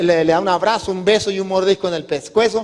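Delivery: ordinary delivery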